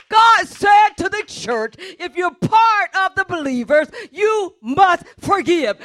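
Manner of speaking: loud, pounding pulpit preaching; a pastor preaching at full force. Acted. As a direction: drive every "you must forgive" home to the congregation. Preaching to the church.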